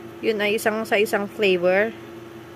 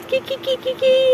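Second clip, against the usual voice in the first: playful high; 13 semitones higher than usual. Acted. Playful sound before pull.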